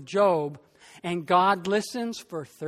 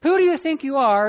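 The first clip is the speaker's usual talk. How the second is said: proclaimed question; 5 semitones higher, 6 dB louder, faster than usual. Acted. Sermon theme line proclaimed.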